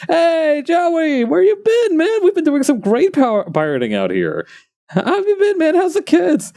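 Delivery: high-pitched, cheery mock greeting; friendly old coworkers. Pirates greeting the ex-coworker hunting them, high oblivious friendliness. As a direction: hail a long-lost pirate pal in a high voice. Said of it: "and they're like".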